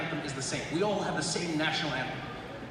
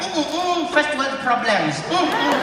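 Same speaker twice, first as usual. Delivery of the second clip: put-on munching kid; 7 semitones higher, 7 dB louder than usual. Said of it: "little kid eating popcorn like".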